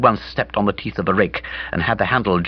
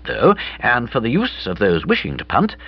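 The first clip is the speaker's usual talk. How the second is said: ordinary delivery, nothing marked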